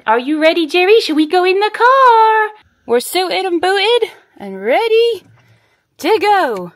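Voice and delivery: high, loud, bubbly voice for the dog; excited dog-talk. Real, not acted. Owner asking her dog if he's ready, high and excited. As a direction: hype the puppy up for the ride.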